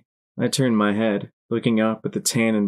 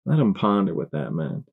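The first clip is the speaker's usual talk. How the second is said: ordinary delivery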